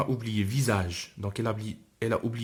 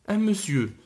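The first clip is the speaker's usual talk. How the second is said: lightly voiced little girl; 9 semitones higher, 5 dB louder than usual. Little girl answers father.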